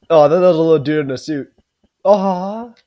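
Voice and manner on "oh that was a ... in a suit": usual speaking voice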